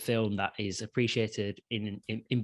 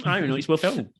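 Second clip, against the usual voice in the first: mocking quote; 5 semitones higher, 4 dB louder, faster than usual. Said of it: "and you go".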